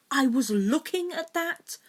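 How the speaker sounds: loud, high indignant protest. A narrator's reading. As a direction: complain loudly, snatched-from. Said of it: "Draco protested".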